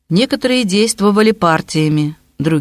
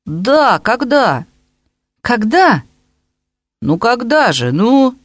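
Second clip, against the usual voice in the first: challenging; 6 semitones higher, slower than usual. A narrator's reading. Boys' escalating back-and-forth.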